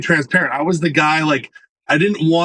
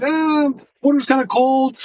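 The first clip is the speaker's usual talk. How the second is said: higher, reluctant wife voice, lightly imitated; hesitant wife; 11 semitones higher than usual. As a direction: mimic a wife hesitating at cold water. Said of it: "She's like".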